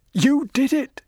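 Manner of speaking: high, loud anguished sob; sobbing. Acted. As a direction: cry it out, broken and accusing. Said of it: "sobbed Winston".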